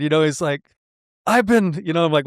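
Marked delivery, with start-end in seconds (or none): 1.3-1.8 mildly exasperated, higher pitch; frustrated. Retold or imitated. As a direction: imitate a frustrated complaint. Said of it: "like, so frustrated".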